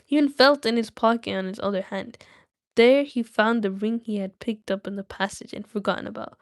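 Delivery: ordinary delivery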